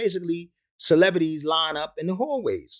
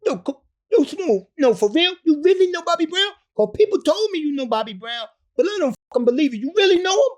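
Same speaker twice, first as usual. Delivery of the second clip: high, loud, incredulous imitation; hammed-up high voice of an amazed cousin; disbelieving cousin; 7 dB louder than usual. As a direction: squeal it like he can't believe it. Cousin disbelieving Bobby connection.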